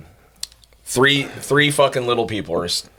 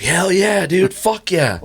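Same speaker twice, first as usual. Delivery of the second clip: stoked cheer; 8 semitones higher, much slower than usual. Retold or imitated. Little guy shouting hell yeah over his record. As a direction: cheer like you just won a plaque.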